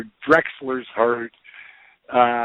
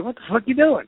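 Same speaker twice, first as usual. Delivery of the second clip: mock surprise; 8 semitones higher, faster than usual. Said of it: "I go".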